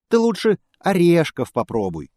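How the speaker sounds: high squeaky squirrel voice. Acted. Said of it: "пищит белка".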